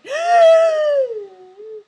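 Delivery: loud, high, sharp intake of breath in alarm; shocked gasp. Real, not acted. Streamer thinks TV died, loud shocked gasp. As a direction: gasp as if something just broke.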